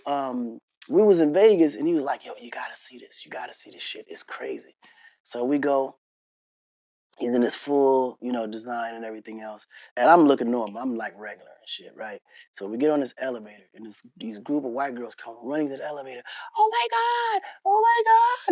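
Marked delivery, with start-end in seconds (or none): 2.3-4.7 very soft, nearly whispered quote; hushed urging. Retold or imitated. Retelling a friend's excited tip, hushed. 16.6-18.5 very high mock squeal; excited fangirls. Voicing girls running to the elevator.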